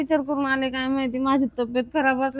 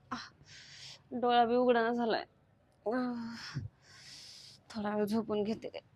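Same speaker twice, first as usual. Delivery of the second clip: groaning in pain; 6 dB quieter than usual. Acted. Sick wife moaning alone.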